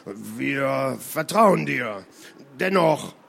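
langsam, mit fremder Stimme; zögernder Seemann. Acted. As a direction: vorsichtig Zweifel anmelden. Redloff zögert, spricht dennoch. (zögerlicher rotbärtiger Seemann)

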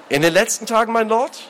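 high, rising question; puzzled echo. Acted. Brother asking the lord what he means, puzzled. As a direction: echo it back, not understanding.

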